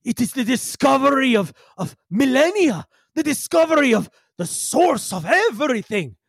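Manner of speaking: put-on high, fervent accented character voice; entreating Yigsel. Yigsel entreating the crew about his discovery, fervent plea. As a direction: plead with high-pitched grandeur. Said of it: "he looks like he's literally, you know, entreating you".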